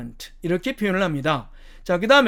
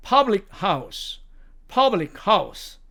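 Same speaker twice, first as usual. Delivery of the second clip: slow demo public house; much slower than usual. Shown as a demonstration. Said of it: "public house 또는 펍이라고 합니다".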